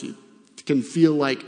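usual speaking voice

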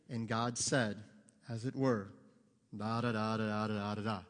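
low monotone drone, imitating a preacher; droning mock sermon reading, pitched low; emotionless reading. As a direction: read it out with no emotion at all. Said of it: "no emotion".

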